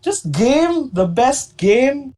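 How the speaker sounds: slow, disbelieving question; really can't believe it; incredulous. Real, not acted. Incredulous repeat of claim.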